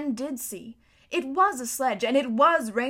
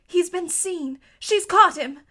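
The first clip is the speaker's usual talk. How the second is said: frightened; 7 semitones higher, 7 dB louder, slower than usual. A narrator's reading. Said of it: "thought Lucy".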